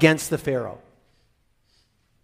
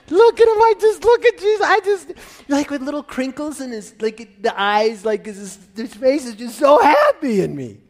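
high, loud, laughing adoration; beaming delight; 9 semitones higher, 5 dB louder than usual. Pretend. God gazing fondly at Jesus, high and laughing. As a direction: gush over him with joy.

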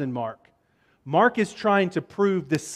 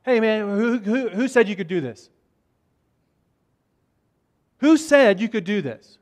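mildly heated mock confrontation; hot challenge; 3 semitones higher than usual. Pharisees confronting Jesus about permission, mildly heated challenge. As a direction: challenge his authority, a touch indignant. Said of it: "come out of the gate pretty hard and pretty hot".

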